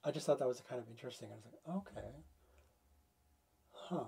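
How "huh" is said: usual speaking voice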